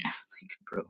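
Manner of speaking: slow mocking whisper. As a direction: breathe it out in disbelief. Mocking the kid's reply.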